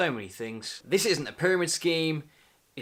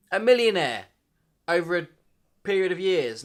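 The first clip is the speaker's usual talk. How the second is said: higher and louder, salesman hype; hyped-up promise; 4 semitones higher, 7 dB louder than usual. Acted. Promising viewers riches, hyped salesman. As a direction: sell the dream big.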